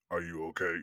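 deep voice. Retold or imitated. Imitating the actor asking the frozen fan if he's okay, low and soft. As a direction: drop low like Tony Todd. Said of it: "'cause he has a really deep voice".

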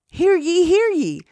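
high proclamation call; town crier. Acted. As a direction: announce it like a herald. Acting Apple Bloom opening meeting.

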